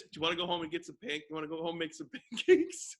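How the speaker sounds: hammed-up suggestive question, squeaky finish; stilted video actor. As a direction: imitate a cheesy video line, cracking up. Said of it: "it ended like this".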